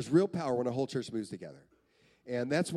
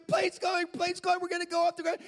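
put-on high, loud kid babble; boy going nuts; 4 dB louder than usual. Boy as the plane starts to go, going nuts. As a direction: bubble over like a thrilled child. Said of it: "he is absolutely nuts".

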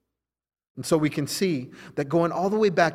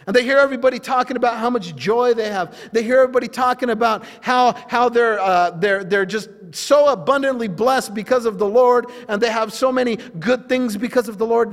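higher, louder, driving sermon voice; forceful preaching; 6 semitones higher, 5 dB louder than usual. Real, not acted. Preacher describing the joy of believers, forceful preaching. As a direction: preach it in an excited, unbroken rush.

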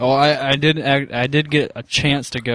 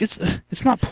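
high whispered retort; 11 semitones higher than usual. Retold or imitated. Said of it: "I'm like".

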